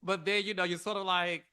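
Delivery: usual speaking voice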